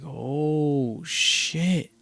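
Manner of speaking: high, drawn-out amazement; retold realization. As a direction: stretch it out as it dawns on you. Retold realization of what he meant.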